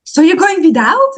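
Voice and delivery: mother questioning her daughter's plan, high and puzzled; mom, surprised. Retold or imitated. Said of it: "And she was like".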